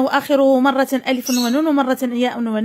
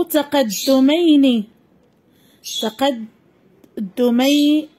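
slow dictation; slower than usual. Shown as a demonstration. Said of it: "dictating the dual form".

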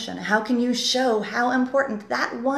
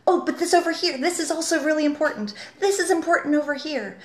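higher-pitched insistent stressing of everything; overdoing the emphasis; 6 semitones higher than usual. Acted. Writing coach mimics writers who stress everything, insistent. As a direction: press each phrase as urgent, pitched high. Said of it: "where you're suddenly saying".

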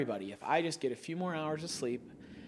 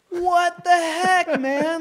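high, loud, slow disbelieving yell; a betrayed cry at a friend; incredulous yell; 12 dB louder, much slower than usual. Retold or imitated. Yelling at the co-worker who caved in. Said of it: "I just remember looking at you like".

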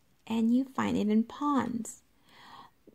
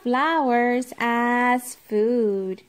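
loud heading; 2 semitones higher, 8 dB louder, much slower than usual. Acted. Reading heading aloud.

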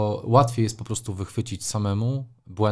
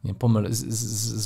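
usual speaking voice

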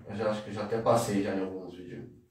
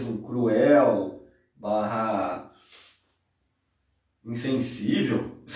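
slow explanation; 2 semitones lower, much slower than usual. Shown as a demonstration. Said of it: "explaining a word".

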